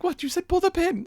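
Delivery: high-pitched mock protest; indignant protest. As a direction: squabble back in an imitated indignant voice. Acted quarrel over misheard order.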